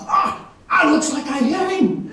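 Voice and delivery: very high, squealing mock excitement; astonished elation. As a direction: cry out high, half laughing, amazed at yourself. Acting out astonished joy after shot.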